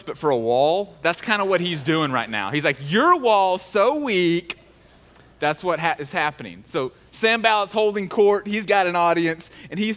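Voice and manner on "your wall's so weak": slow, drawn-out playground taunt; yo-mama taunt. Acted. Sanballat roasts the wall, a slow mocking taunt. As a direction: stretch each word like a schoolyard burn. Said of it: "like your mama jokes".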